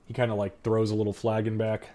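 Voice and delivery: ordinary talk, nothing marked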